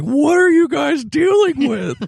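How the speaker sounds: very high, loud, drawn-out disbelief; comic incredulous outburst; mock-outraged founding father. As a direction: wail it like an appalled founding father. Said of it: "Right after Jefferson died, they would have been like".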